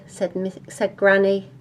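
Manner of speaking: usual speaking voice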